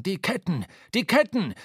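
calling out. A narrator's reading. Starbuck warns the crew in the storm, narrated alarmed cry. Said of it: "rief Starbuck der Mannschaft zu".

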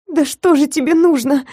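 high, desperate hiss. Acted. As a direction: hiss it, holding back tears. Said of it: "прошипела я".

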